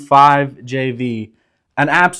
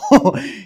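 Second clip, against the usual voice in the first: breathy laugh; 7 semitones higher than usual. Real, not acted. Laughs after calling commenter asshole.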